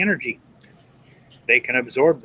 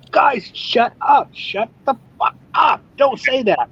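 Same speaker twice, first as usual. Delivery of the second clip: exasperated outburst; 11 semitones higher, slower than usual. Retold or imitated. Retelling telling streamers off.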